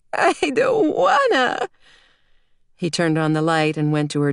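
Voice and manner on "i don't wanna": put-on very high, drawn-out childish whine; whining little girl. A narrator's reading. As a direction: stretch out a preschooler's stubborn refusal. Small girl refuses preschool again.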